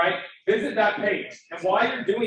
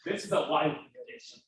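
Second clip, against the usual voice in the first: getting emotional; 5 semitones lower, 7 dB quieter than usual. Real, not acted. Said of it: "I'm sorry I'm getting emotional".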